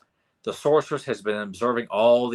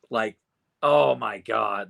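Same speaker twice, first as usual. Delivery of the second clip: usual speaking voice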